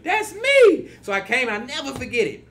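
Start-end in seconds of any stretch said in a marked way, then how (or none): 0.0-0.9 high, loud exclamation; triumphant realization. Retold or imitated. Claiming the song's little homie as himself, triumphant shout. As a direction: claim it with delighted force.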